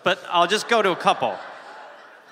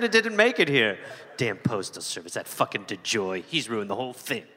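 goofy character voice; 5 semitones lower, 3 dB quieter than usual. Acted. S0 plays a goose-mailing character.

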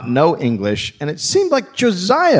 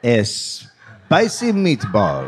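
slow, hammed-up Italian; fake Italian accent; 2 semitones lower, much slower than usual. English deserter posing as Italian, hammed-up accent. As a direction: draw it out in cartoon Italian. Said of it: "We're Italians".

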